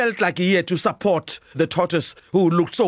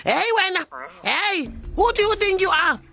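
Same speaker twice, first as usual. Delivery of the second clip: high, cheeky taunting voice up top; small creature shouting defiance; tortoise yelling taunts; 10 semitones higher than usual. Acted. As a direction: pipe up at the monster, daring it.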